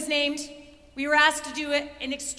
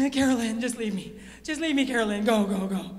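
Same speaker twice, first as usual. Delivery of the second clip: acted breathless, low pleading; out of breath; 6 semitones lower than usual. Re-enacting herself begging teammate to go on, acted breathlessness. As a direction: pant it out as a spent runner. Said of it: "Guess who stops? I do".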